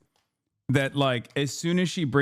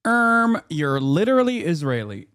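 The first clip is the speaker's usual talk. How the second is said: mock pedantic interjection; 3 semitones higher than usual. Streamer butting in on the Israeli guest, exaggerated pedantic mockery.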